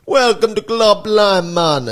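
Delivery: put-on Jamaican accent. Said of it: "they're automated".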